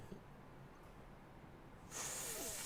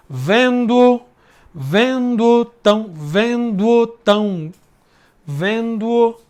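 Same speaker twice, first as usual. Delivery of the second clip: higher, syllables pulled apart; slow scansion; 5 semitones higher, 4 dB louder, slower than usual. Shown as a demonstration. Teacher scanning the second verse for the class, slowly. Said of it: "aqui emenda também".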